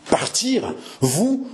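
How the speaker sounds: high-pitched, incredulous growled questions; scolding disbelief. A narrator's reading. Henri scolds Jeanne for wanting to leave, incredulous. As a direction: throw each word back as an indignant question. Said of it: "gronda le frère de François".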